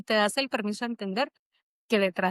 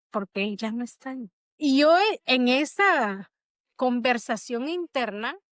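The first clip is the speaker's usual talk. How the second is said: ordinary delivery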